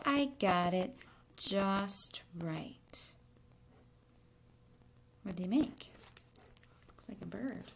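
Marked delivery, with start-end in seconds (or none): none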